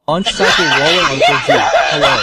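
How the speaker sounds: loud jeering call with a laugh; mocking laughing shout. Neighbours jeer at the boy, laughing shout.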